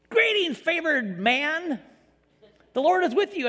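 a hammed-up heavenly herald; mock angel greeting. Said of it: "if an angel showed up in my house".

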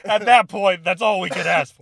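loud, high exasperated yell, played for laughs; exasperated mock shout. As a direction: shout it like a fed-up superior. Acting out exasperated army superiors.

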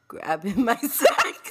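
cracking up mid-quote; laughing through it. Retold or imitated. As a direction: laugh through the last words. Retold flirt quote, laughing.

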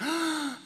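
a high, surprised intake of breath; high mock gasp. Pastor reacting to the name Trinity, a high mock gasp. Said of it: "I'm like".

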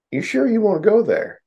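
high, doubting challenge; incredulous retort. Retold or imitated. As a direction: warn them they're treading on thin ice. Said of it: "And I was like".

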